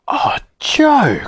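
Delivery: very high, wailed protest; pleading cry. A narrator's reading. Bessie begging Joe to go, crying out. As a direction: whine it out in protest. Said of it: "cried Bessie".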